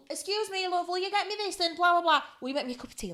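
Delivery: high-pitched imitation of chatty mum; mum calling out. Mum asking people for tea during nails, high call. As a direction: call sweetly across the room for favours.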